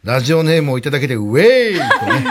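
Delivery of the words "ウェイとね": long drawn-out mock cheer; egging on. Mail imitates girls hearing a radio name, egging on. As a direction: stretch it out like a rowdy heckle. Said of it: "煽ってくるあたり".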